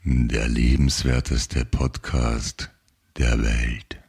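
slow, low jingle announcer; deep announcer voice. Acted. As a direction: drag it out like a radio jingle. Mock jingle announcer read.

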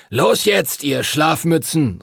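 teacher calling the pupils from the doorway. Acted. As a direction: summon the sleepyheads from the door. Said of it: "rief Master Duncan".

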